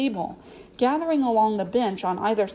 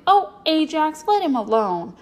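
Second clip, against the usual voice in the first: loud, high start on an exasperated telling-off; angry; 6 semitones higher, 5 dB louder than usual. A narrator's reading. Girl defends newcomer from her riding partner, angry. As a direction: scold him angrily, easing down in pitch. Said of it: "Janet tossed her head angrily".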